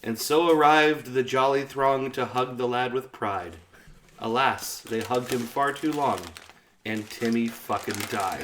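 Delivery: measured rhyming verse read; poem recitation. Acted. Reciting the Timmy poem to friends, slow and measured. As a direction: recite it like a solemn ballad.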